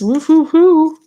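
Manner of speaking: high, loud woo-hoo of delight; excited cheer. Real, not acted. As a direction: whoop like your card just won. Cheering a lucky tarot card.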